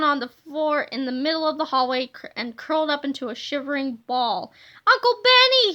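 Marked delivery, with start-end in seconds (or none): 4.9-5.7 high, loud desperate wail. A narrator's reading. Kipper wails for his uncle from the floor, desperate. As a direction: cry out for help, whimpering. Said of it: "he wailed".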